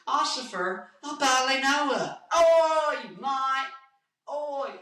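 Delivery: high, slow put-on British accent. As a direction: do a silly drawn-out British voice. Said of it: "That's my Britain".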